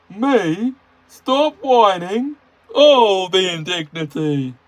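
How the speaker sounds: high, loud, slow indignant huff; stroppy Gordon. Acted. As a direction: sulk out loud. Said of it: "said Gordon stroppily".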